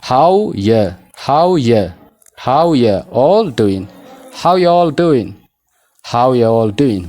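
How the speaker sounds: "How ya all doing", you weakened to YA, no are; reduced how ya. Shown as a demonstration. Teacher drilling learners, slow reduced pronunciation. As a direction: drill "How ya" first, then stretch on into all doin'.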